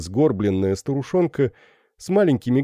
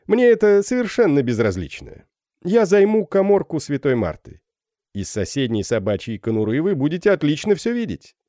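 беспечный капитан; 6 semitones higher than usual. Acted. Феб отвечает незнакомцу.

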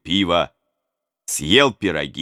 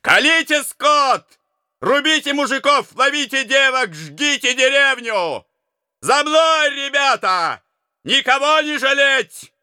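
savage commands. A narrator's reading. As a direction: bark out the raid orders. Oprichnik leader orders the raid.

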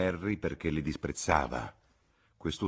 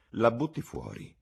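high-pitched, daring suggestion; brisk bit of audacity; bold; 6 semitones higher than usual. A narrator's reading. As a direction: propose it boldly. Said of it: "propose arditamente Cecil".

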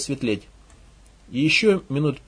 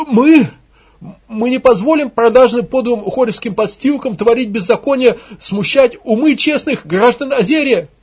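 loud, raised demagogue's wail; hysterical ranting cry; 7 semitones higher, 6 dB louder than usual. Acted. Propagandist stirring up the crowd, hysterical cry. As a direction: cry out like a frenzied agitator. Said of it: "кликушески возопил пропагандист".

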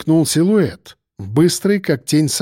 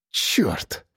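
soft low breathed curse; 6 semitones lower, 7 dB quieter than usual. A narrator's reading. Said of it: "выдохнул я".

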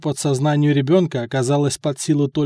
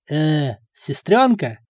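slow, puzzled voice; puzzled slow question; slower than usual. Acted. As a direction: ask it slowly and unsure. Sasa's puzzled reply.

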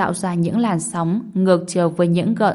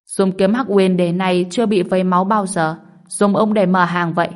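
usual speaking voice

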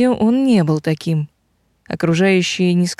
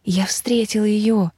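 slow, unvoiced confiding whisper; whispering in ear; 3 semitones higher, slower than usual. A narrator's reading. Dragon confiding in his friend's ear, whispered. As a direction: whisper it slowly, as a secret. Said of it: "зашептал мне в ухо".

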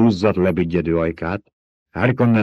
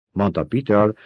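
usual speaking voice

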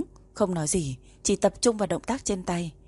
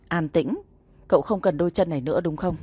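ordinary delivery, nothing marked

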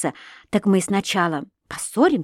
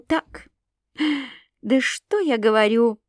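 usual speaking voice

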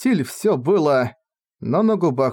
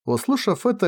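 ordinary delivery

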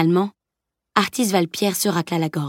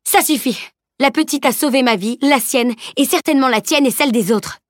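higher, indignant defence; indignant outburst; 6 semitones higher than usual. Acted. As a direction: cut him off and defend the girl. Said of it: "Elana lui coupa la parole".

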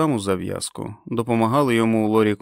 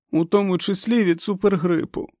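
високий схвильований жіночий голос персонажа; перепитай тривожно, значно вище; тривожне перепитування; 9 semitones higher than usual. A narrator's reading. Заплакана Френ перепитує лікаря. (тривожно, високим голосом)

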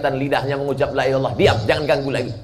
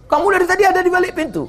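surprised; 3 dB louder, much faster than usual. Acted. Surprised question.

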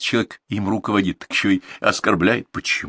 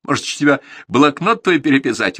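with a smile; 8 semitones higher, 5 dB louder than usual. Acted. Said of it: "улыбнулся Игорь".

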